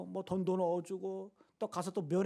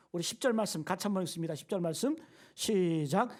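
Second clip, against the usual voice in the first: usual speaking voice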